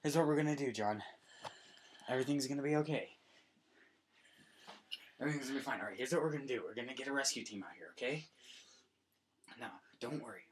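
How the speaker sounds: calm John down gently; reassuring. Acted. Comforting John.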